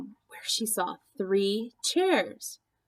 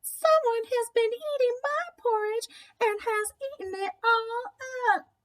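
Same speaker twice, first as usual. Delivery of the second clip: put-on very high tiny baby bear voice; Baby Bear wailing about his eaten porridge; wee little voice. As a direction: squeak it like a tiny cub. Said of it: "in his wee little voice".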